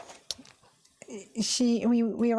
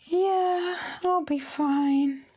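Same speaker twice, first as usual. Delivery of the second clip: pitiful reply; 6 semitones higher, much slower than usual. Retold or imitated. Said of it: "I'm like".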